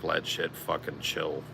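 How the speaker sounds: ordinary talk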